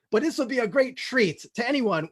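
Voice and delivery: ordinary talk, nothing marked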